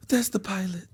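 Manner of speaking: high, soft casual answer; high mock-innocent girlfriend; imitating woman friend. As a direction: answer lightly in her high voice. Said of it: "Who was that?".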